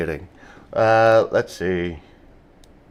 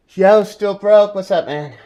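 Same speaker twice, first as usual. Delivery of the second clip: loud greeting; 10 semitones higher, 10 dB louder than usual. Real, not acted. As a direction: call out to a chatter. Greeting a chatter.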